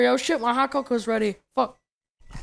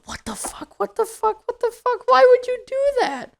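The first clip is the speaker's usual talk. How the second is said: rising high panicked repeats; mock panicked teacher thinking; panicked inner voice; 14 semitones higher than usual. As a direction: climb higher with each repeat. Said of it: "she's just thinking in her head".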